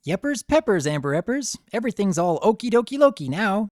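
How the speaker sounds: bubbly Pinkie voice. A narrator's reading.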